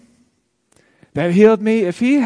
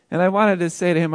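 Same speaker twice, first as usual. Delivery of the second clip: ordinary talk, nothing marked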